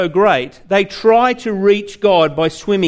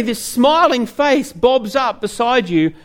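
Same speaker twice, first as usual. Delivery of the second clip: normal talking voice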